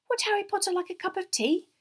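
high, squeaky house-elf voice; eager little elf servant, pitched very high; loud squeak. A narrator's reading. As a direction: squeak the polite offer brightly. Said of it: "he squeaked loudly over Winky's sobs".

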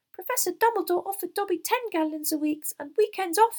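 very high, loud elf squeak; emphatic little servant, pitched high; eager house elf. A narrator's reading. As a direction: confide the offer in a squeaky voice. Narrator voices Dobby the house elf.

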